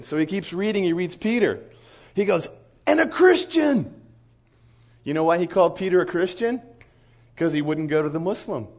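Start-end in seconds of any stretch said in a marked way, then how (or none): 2.9-3.9 high, loud and drawn out; imitated indignant exclamation. Sheikh reading about Peter, loudly indignant. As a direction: pounce on the word as an accusation.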